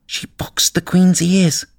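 high, slow small voice; drawn-out high-pitched rabbit voice; nervous rabbit. A narrator's reading. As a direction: confide the gossip as a little rabbit. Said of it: "the Rabbit began".

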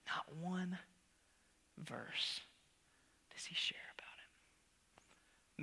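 hushed unvoiced whisper for effect. Real, not acted. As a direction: drop to a secret whisper. Preacher drops to whisper.

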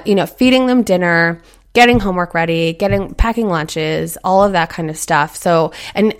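normal talking voice

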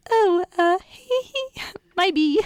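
high, awkward hesitant little voice; mock flustered giggle. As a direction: play her caught out, tittering nervously up high. Voicing flustered Elizabeth giggling.

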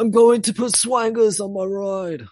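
high, loud, badly faked Australian accent; bad Aussie accent. Teasing an Australian with a line about swangers, bad Aussie accent. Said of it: "You sound like the Ramones, not an Australian".

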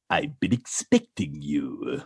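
very low, slow evil emperor; sinister, drawn-out villain greeting; creaking dark lord, deep and slow; Emperor Palpatine. Acted. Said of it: "Emperor Palpatine".

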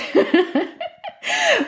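a quick giggle at the memory; light laugh. Real, not acted. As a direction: laugh at how it went. Laughs at own story.

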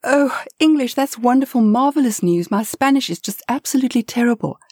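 high, bubbly and relieved; delighted tourist gushing; delighted relief. Acted. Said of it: "Lynette laughs".

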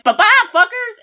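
high, cheeky send-off; mock reindeer farewell. Voicing reindeer ditching the humans, high and cheeky. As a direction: toss it off like a rude reindeer. Reindeer voiced leaving the elves.

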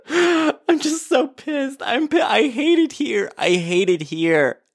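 high, slow, wailing fake sob; hammed-up teary wail; mock crying whine. Crying whine over hating it.

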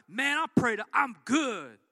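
loud breezy fake-fine churchgoer; mock churchgoer. Preacher mocking a churchgoer, loud and breezy. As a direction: brush it off loudly, pretending all is well.

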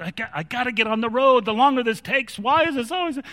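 high-pitched, frantic venting; freaking out. Retold or imitated. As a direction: re-enact a mounting panic over being held up. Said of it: "freaking out".

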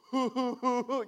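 put-on goofy cartoon laugh; imitated cartoon laugh. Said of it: "It's like Patrick from SpongeBob".

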